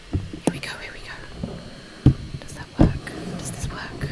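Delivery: breathy half-to-self whisper. Real, not acted. Trying to boost the microphone for viewers, whispered. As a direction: whisper while fiddling with the mic.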